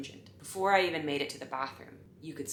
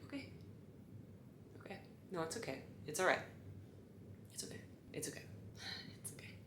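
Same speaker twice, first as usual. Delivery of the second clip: hushed; 18 dB quieter, much faster than usual. Retold or imitated. Attendant calming herself, hushed.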